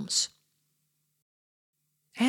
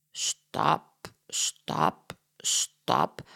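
"Stap, stap, stap" dropping much lower and softer, near-whispered; imitating footsteps; 11 semitones lower, 3 dB quieter, much slower than usual. Detective copies the parrot's footstep sounds, low and soft. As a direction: thud each step down low like footfalls. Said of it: "Meneer Holmes doet de geluiden na".

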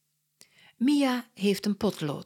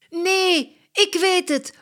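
high, loud, excited cry; calling out; 12 semitones higher, 8 dB louder than usual. Acted. Mia has an idea for the school talk, excited call. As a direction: burst out with the idea. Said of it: "roept Mia".

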